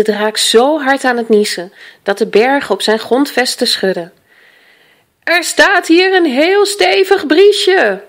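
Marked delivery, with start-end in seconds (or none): 5.2-8.0 high, loud, drawn-out bellow; full-voiced, stretched-out holler; roaring. A narrator's reading. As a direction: roar it slowly over a gale. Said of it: "brulde de grootvader".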